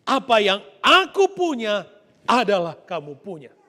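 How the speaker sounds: loud, insistent, drawn-out anakku; emphatic father. Acted. Father insisting to his son, loud and firm. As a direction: press the point home. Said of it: "Bapak pertegas itu".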